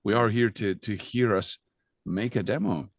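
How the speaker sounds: normal talking voice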